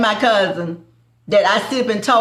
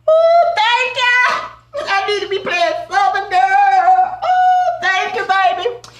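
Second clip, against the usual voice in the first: delighted squeal; 12 semitones higher, slower than usual. Real, not acted. Thrilled that thousands of viewers are watching, a high squeal.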